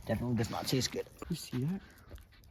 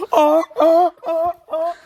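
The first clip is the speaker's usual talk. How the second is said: excited yelping; 14 dB louder, slower than usual. Pretend. Pretend alarm.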